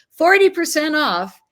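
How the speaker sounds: high, loud sales pitch; excited announcement. Real, not acted. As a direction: proclaim the deal with excitement. Announcing the big discount.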